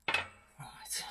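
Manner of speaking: whisper. Real, not acted. Concentrating on a careful cut.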